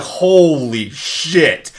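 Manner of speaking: slow, stretched-out amazement; stunned reaction. Retold or imitated. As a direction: draw it out in loud disbelief. Acting out stunned viewer reaction.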